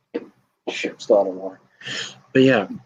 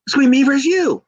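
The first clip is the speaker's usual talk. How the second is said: high and a bit louder, cheerfully breaking the news; eager Slater; 11 semitones higher, 4 dB louder, faster than usual. Retold or imitated. Slater telling Shorty G about their match, high and eager. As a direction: pitch it up like a keen pitchman.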